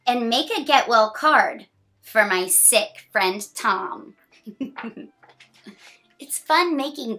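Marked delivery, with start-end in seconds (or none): 0.1-4.1 bright, sing-song picture-book reading; reading to children. A narrator's reading. Reading a picture book to a child, sing-song. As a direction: read the rhyme cheerfully to a small child.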